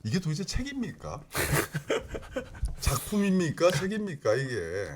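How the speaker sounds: high, excited home shopping pitch; infomercial host. Pretend. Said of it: "홈쇼핑처럼 해도 될까요?". (홈쇼핑 쇼호스트처럼 들뜬 목소리)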